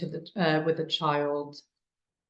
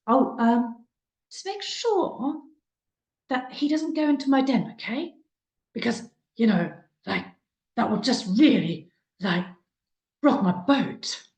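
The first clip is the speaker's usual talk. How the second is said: high, mock-dramatic tease to the other adults; playful; 6 semitones higher than usual. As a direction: tease so the child overhears. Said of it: "tried to get a little bit of playfulness in".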